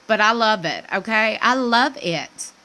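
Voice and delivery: ordinary talk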